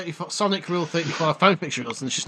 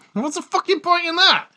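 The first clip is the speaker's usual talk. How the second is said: exasperated rant; 13 semitones higher than usual. Real, not acted. Ranting about an annoying advert.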